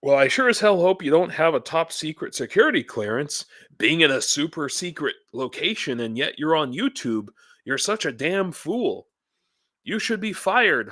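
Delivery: mock troll voice. Vlogger reading a troll's message aloud, high mocking voice. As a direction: mimic the troll's smug message. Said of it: "this is my intonation".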